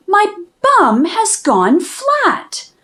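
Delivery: ordinary delivery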